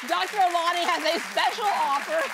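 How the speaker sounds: high, loud bubbly announcement; excited announcer. Acted. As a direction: sell it with big excitement. Repeated offer, first bubbly take.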